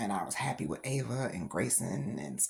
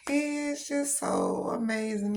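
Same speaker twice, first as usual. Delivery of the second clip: proud gushing; 13 semitones higher, 3 dB louder than usual. Real, not acted. Gushing about her son.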